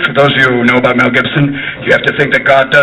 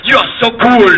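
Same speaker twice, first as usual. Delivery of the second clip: put-on higher, admiring French accent; 7 semitones higher than usual. French admiring Jack as president, French accent. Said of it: "Even the French would go".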